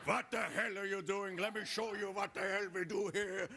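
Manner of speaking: put-on tough old grandfather with a German accent. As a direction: put on a thick German accent, gruffly impatient. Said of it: "He talks like this".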